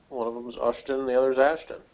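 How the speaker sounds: normal talking voice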